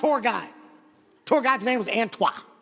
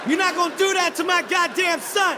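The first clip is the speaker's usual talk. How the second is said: raised-pitch angry protest; indignant refusal; 5 semitones higher than usual. Retold or imitated. Father refusing to let his son act like a gorilla, acted outrage. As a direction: refuse outright, high and emphatic. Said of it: "I got mad".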